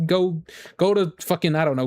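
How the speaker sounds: ordinary delivery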